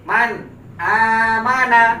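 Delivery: "Man amana" slow, with the n cut short and no buzz; short clipped n. Shown as a demonstration. Teacher to class, showing the short n. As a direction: clip the nasal short, don't let it ring. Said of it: "Maka dibaca pendek saja".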